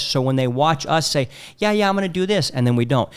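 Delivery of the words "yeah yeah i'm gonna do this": higher-pitched breezy assurance; empty promise. Retold or imitated. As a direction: mimic a man brushing it off with a promise. Mimics men making empty promises.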